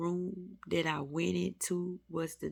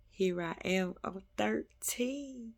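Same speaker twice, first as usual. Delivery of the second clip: ordinary talk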